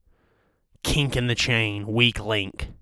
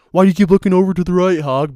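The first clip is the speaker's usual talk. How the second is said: fast, higher, louder mock viewer; mock viewer question; 7 semitones higher, 7 dB louder, faster than usual. Imagined viewer quizzing the host, fast and pitched up. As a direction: rattle it off as a nosy viewer.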